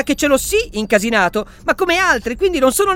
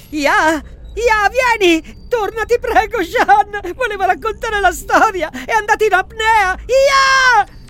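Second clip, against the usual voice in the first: high, frantic pleading; panicked; 8 semitones higher, slower than usual. Acted. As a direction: cry out in panic, near tears. Panic after friend collapses.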